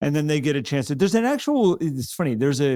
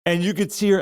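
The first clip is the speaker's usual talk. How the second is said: ordinary talk, nothing marked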